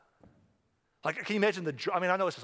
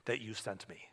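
whispered prayer; 7 semitones lower, 11 dB quieter than usual. Retold or imitated. Prayer voiced, unvoiced.

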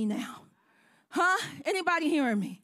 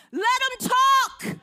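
slow, louder, higher defiant cry; defiant shout; 5 semitones higher, 5 dB louder, slower than usual. Acted. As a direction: throw it back at the critics. Defiant preacher line.

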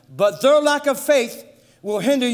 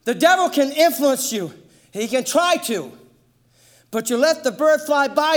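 ordinary delivery

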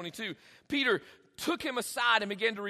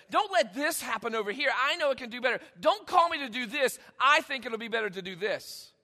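rapid, emphatic protest; mock pushy prayer, piling up objections; insistent pleading; 4 dB louder than usual. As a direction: argue urgently that you know better. Acted pleading prayer telling God better.

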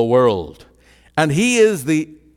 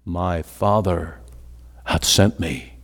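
low, slow calm answer; 7 semitones lower, 5 dB quieter, slower than usual. Retold or imitated. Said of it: "Jesus answering the questioners".

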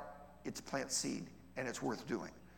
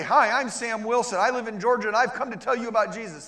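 stranger's pitch; 7 semitones higher, 9 dB louder than usual. Retold or imitated. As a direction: play the pushy outsider with a big smile. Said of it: "and say".

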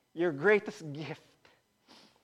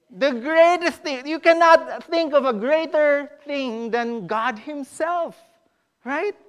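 loud, slow, raised-pitch proclamation; forceful preaching; 5 semitones higher, 9 dB louder, slower than usual. Real, not acted. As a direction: proclaim it slowly to the whole congregation.

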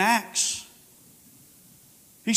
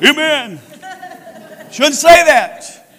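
firm preaching; 4 semitones higher, 6 dB louder, much slower than usual. Acted. Preaching at full force.

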